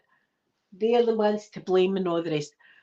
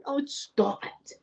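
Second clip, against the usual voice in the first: slow, higher mocking "oh, stop it"; sneering teacher; 6 semitones higher, 6 dB quieter, slower than usual. Teacher scolding a frightened girl, slow sneer. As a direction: sneer at the scared child slowly. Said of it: "she kept going".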